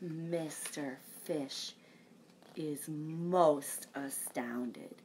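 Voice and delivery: slow, hushed whisper. A narrator's reading. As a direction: let the shock hang in a whisper. Fish stunned by the kiss.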